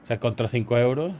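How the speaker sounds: usual speaking voice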